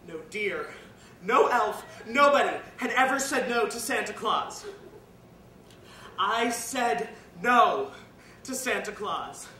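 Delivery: loud, slow, hammered phrases with pauses; defiant declaration. Acted. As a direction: proclaim the defiance forcefully, phrase by phrase. Defiant climax, refusing Santa.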